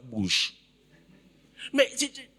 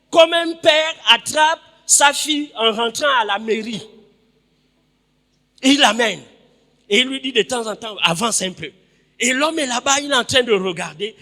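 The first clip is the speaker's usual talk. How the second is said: loud, high-pitched sermon delivery; full-force preaching; 7 semitones higher, 9 dB louder than usual. Real, not acted. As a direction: proclaim the story to the whole congregation. (prédication à pleine voix)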